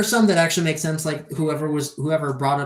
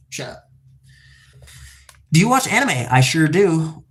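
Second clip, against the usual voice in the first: ordinary talk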